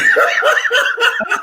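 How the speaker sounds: a big high burst of laughter; hearty high laugh. Real, not acted. Laughs at his own joke.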